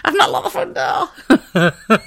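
slow, high, breaking into laughter; laughing. Real, not acted. Host recalling nights out, crying with laughter. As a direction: say it through tears of laughter. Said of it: "I'm absolutely crying".

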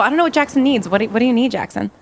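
normal talking voice

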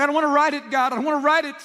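high, loud pleading refusal; retold begging. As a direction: beg God not to make you write it, pitched high. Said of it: "I'm just begging him".

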